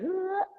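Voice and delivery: slack, mushy sound sliding up high; loose numb-tongue noise. Shown as a demonstration. Actress showing her American accent placement, slack-tongued noise. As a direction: let the tongue flop into a rising noise. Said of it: "my tongue just kinda goes".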